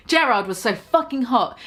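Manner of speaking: ordinary delivery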